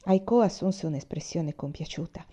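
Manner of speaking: plain reading voice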